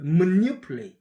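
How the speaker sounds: emphatic native manipulate; native manipulate, stressed. Shown as a demonstration. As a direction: hit the NI of manipulate hard. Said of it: "이렇게 발음해요".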